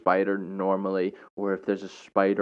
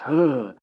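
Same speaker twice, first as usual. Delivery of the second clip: disgusted groan; 6 semitones higher, 6 dB louder than usual. Retold or imitated. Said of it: "I was like".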